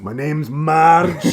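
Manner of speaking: put-on loud, high, drawn-out Scottish accent; hammed-up Scottish lady announcing herself; big Scottish lady. Big Scottish lady making her entrance, hammed-up Scottish. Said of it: "like, a big Scottish lady".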